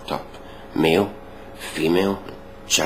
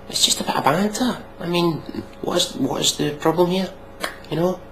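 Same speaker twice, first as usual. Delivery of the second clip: high, sneering mock of the complainers; 11 semitones higher than usual. Sneering at complainers in a mock high voice. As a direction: pitch it way up to mock them.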